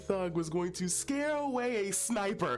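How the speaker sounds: incredulous, laughing. Real, not acted. Teller mocking her own worry about the sniper, incredulous laughing. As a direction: crack up on the absurd last word. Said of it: "how fucking dumb I sound".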